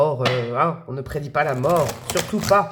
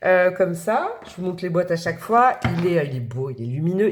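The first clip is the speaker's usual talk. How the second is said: usual speaking voice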